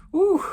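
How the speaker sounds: high, loud "whew"; sharp, high exhale of relief; sigh of release. Real, not acted. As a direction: let out a high whoop of relief. Releasing tension after sharing feelings.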